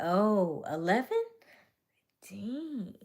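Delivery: normal talking voice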